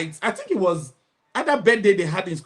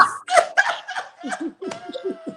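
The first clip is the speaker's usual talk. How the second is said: high shrieking laugh; 4 dB quieter than usual. Real, not acted. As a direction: burst into a high squealing laugh. Bursts out laughing.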